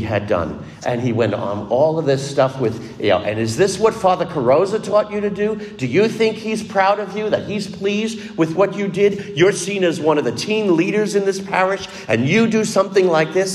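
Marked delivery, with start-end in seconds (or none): 3.2-13.5 raised, higher scolding father's tirade; father yelling at his teenage son, mock angry; retold angry scolding. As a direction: lace into the son like the furious father. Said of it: "began again to lace into his son".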